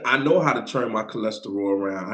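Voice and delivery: ordinary talk, nothing marked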